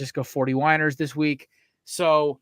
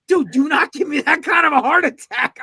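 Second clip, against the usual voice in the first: much higher, louder mock scolding; retold exasperated shout; 10 semitones higher, 7 dB louder than usual. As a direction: yell it at your coworker in relief. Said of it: "I'm like".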